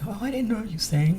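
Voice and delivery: lightly voiced surprised coworker. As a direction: play the coworker who just found out. Coworker surprised at her singing.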